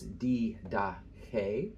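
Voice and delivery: "didache" slowly, syllable by syllable, accent on the final syllable; stress on the last syllable. Shown as a demonstration. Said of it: "The accent is here on the last, the last syllable".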